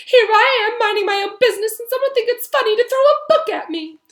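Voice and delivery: put-on high, loud wailing girl ghost; miserable ghost girl. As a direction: sulk and wail like a self-pitying ghost. Myrtle voice held.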